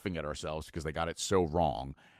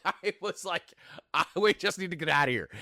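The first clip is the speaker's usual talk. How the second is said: laughing through the retold line, pitched up; 9 semitones higher than usual. Real, not acted. Husband retells the restaurant exit to a friend, laughing. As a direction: laughs out the words, can barely keep it together.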